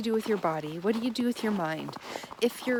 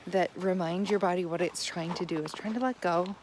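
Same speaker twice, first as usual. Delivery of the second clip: ordinary talk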